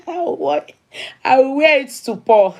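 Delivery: giggling through the words; real laughter breaking in; tickled and laughing as she speaks. Real, not acted. Giggling about wearing her outfit.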